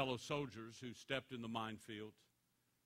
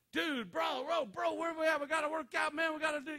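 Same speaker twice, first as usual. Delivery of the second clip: higher, pumped-up bro voice; hyped-up buddy; 13 semitones higher, 5 dB louder than usual. Retold or imitated. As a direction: call like an eager gym buddy. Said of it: "and he said".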